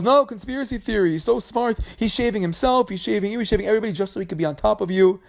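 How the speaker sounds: a wife stirring up her husband, voiced high and pushy; mock whiny wife. Korach's wife complaining to Korach.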